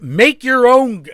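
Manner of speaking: high, loud, slow exasperated shout. Real, not acted. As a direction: burst out in frustration. Frustrated outburst.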